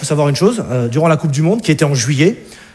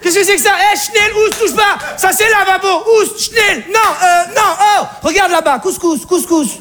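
Alsatian mother; 11 semitones higher than usual. Acted. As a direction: chase him off like a stray. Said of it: "la mère alsacienne arrive".

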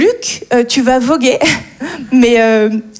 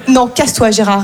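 ordinary delivery

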